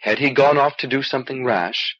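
ordinary delivery, nothing marked

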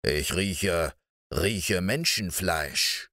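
low, slow sniffing giant voice; a menacing ogre savouring the scent; eerie giant. Acted. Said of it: "sagte er unheimlich".

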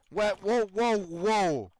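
high, loud startled whoas. Real, not acted. Gamer startled by a horse bolting, loud and high. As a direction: jump back in alarm.

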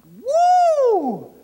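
high, loud whoop; a huge whoop of praise in the car; joyful woo. Retold or imitated. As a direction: let out a big joyful yell. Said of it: "I was just yelling".

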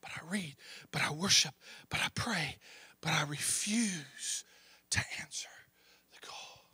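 soft, hushed, almost breathless; whispered confession. Real, not acted. Preacher voicing a believer who refuses, whisper.

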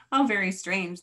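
usual speaking voice

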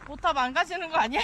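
loud call across the slope. Real, not acted. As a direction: shout it out to be heard. Calling out on the slope.

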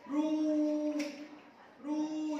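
long, held "رو" as "roo"; damma sound. Shown as a demonstration. Teacher showing kindergartners the damma sound, "رو" held long and rounded. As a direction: round the lips and stretch "رو". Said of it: "هنا نضم الراء كده في صوت الحرف".

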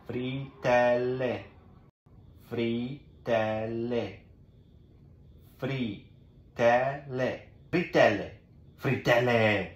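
fritelle said slowly, syllable by syllable; teacher demo; slow Italian teaching. Shown as a demonstration. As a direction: model fritelle slowly for a child. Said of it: "You can say either pancakes as well, or".

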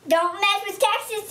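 "Don't mess with Texas" said high in a hammed-up Texas twang; playful Texas twang on "Don't mess with Texas"; Texas accent. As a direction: belt "Don't mess with Texas" like a proud Texan. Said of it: "that was just my accent for Texas".

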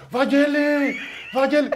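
high-pitched imitation of calling a name; retold calling out. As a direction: mimic people calling up to a balcony. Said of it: "και φωνάζουν".

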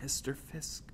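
soft, hesitant whisper; hushed, timid call. A narrator's reading. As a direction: whisper to get his attention. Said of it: "I whispered".

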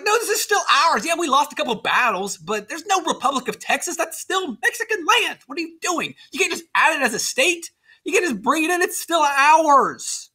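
high, exasperated acted objection; indignant protest. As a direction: play the wronged owner arguing back. Acted Mexican protest over Texas.

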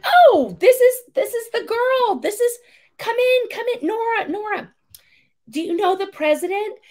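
very high, loud, gushing voice; gushing celebrity. Retold or imitated. Singer introducing the girl to the president, high and gushing. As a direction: gush sweetly over the girl.

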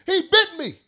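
high, loud yell; angry shout. Retold or imitated. Man yelling back at the apologizing owner, high loud shout. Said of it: "I said".

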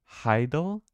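Heidel said slowly, rising like a question; tentative pronunciation. Shown as a demonstration. Gamer guessing at a word from the dictionary, tentative pronunciation of Heidel. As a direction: try out Heidel with a questioning lift. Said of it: "It's pronounced Heidel".

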